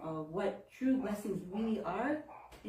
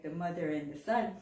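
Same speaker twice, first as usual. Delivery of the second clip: normal talking voice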